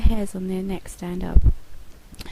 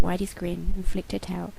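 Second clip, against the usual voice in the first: plain reading voice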